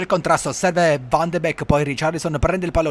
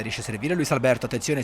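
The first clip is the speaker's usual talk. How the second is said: ordinary delivery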